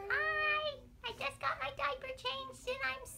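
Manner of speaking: put-on very high little baby voice. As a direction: speak for the baby, proudly. Voicing the baby.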